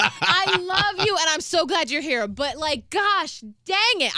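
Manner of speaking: high-pitched exasperated outpouring; drawn-out, strained retold yelling; retold screamed affection. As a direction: yell love through frustration, re-enacted. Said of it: "even when I'm screaming".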